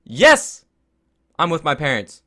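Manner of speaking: high, loud retold outburst; teen shouting at ER desk lady over obvious injury; exasperated shouting. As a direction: yell it back at the receptionist, fed up. Snapping at the ER receptionist.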